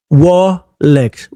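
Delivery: Rolex said as "Wolex", R turned into W; mock a speaker who can't say R, Rolex becomes "Wolex". A vlogger mocking a politician who can't say R: Rolex as "Wolex". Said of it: "hindi ko mabigkas".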